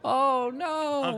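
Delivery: high, hammed-up wail; feigned agony. Player keeps up the broken-leg act, feigned agony. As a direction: draw out a very high mock lament. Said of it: "having broken his leg".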